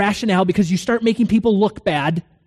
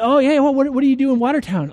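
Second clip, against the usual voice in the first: high, quick retold questions; chatty, higher-pitched prying; eager questioning; 8 semitones higher, 3 dB louder, faster than usual. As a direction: press him with rapid, keen interest. Acting his eager questioning.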